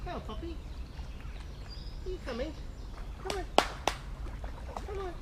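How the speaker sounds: very high, quiet puppy voice. Real, not acted. Calling the puppy out of the river in high cooing.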